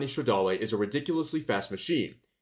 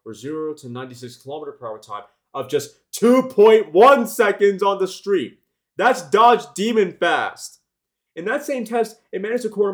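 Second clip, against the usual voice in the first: excited; 6 semitones higher, 9 dB louder than usual. Real, not acted. Hyping a record launch time.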